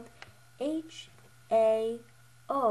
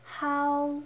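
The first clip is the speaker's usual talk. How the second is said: "How" held high and level; first tone; 5 semitones higher, 5 dB louder than usual. Shown as a demonstration. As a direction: stretch "How" on one high pitch. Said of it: "pronunciation tones".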